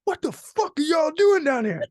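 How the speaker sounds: high, loud mock yell; imitated angry cabbie. Cabbie confronting travellers in downtown Detroit, high-pitched mock yell.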